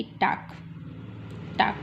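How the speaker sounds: टाक ending in a crisp क; final g as k. Shown as a demonstration. Teacher showing the final क in टाक. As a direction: say टाक with a hard क at the end. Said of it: "तो उसकी sound क आएगी".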